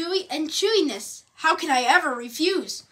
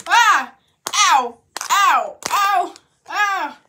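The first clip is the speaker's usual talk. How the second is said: pained yelps; 5 semitones higher, 7 dB louder, much slower than usual. Acted. Falling and getting hurt.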